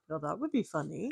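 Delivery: lightly voiced Italian accent. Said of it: "Is that your Italian accent".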